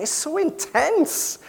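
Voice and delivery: high, slow mock-wife voice; drawn out high and put-on, "It's so intense."; imitating his wife. Husband mimics his wife complaining about talk radio, high mock voice. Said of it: "And Hannah's saying".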